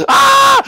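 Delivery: a very high, loud yelp of panic; loud high shriek; panicked scream. Acted. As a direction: scream in alarm. Chaos as plan fails.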